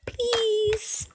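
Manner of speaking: very high, small pleading squeak; high squeaky plea; squeaky please. Pretend. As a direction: squeak it like a tiny begging child. Begging herself to splatter.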